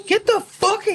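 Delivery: high imitation of an angry rebuff; mock angry yell. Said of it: "he's just, like, really mad".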